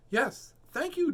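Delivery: high, slow thankful storybook voice; Missus Rabbit. A narrator's reading. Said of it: "says Missus Rabbit".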